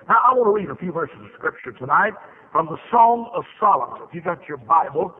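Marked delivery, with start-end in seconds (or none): none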